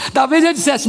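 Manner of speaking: ordinary delivery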